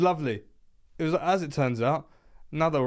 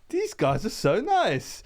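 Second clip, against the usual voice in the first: delighted; 9 semitones higher, slower than usual. Retold or imitated. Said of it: "And I went".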